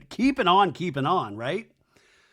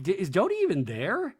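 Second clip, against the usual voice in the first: normal talking voice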